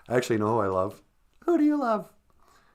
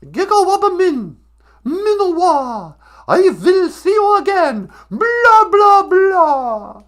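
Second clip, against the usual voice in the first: high, shouted mock farewell; hammed-up blaring in a very high voice; Greta Thunberg; 14 semitones higher, 10 dB louder, slower than usual. Activist says goodbye in Ojibwe, mock high shouting. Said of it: "See ya, Greta".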